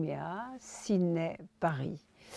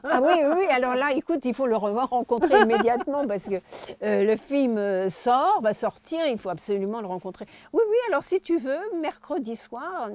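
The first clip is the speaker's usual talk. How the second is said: louder, higher eager rush; loud excited; 6 semitones higher, 7 dB louder than usual. Retold or imitated. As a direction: gush with overexcitement. Eager reply, acted excitement. (réponse surexcitée et forte)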